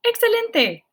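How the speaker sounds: high, loud "Excelente"; excited praise. Real, not acted. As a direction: cheer the class on. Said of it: "praising students".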